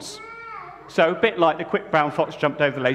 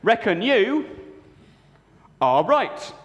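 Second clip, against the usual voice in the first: put-on northern accent; 6 semitones higher, much slower than usual. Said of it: "inexplicable northern accent".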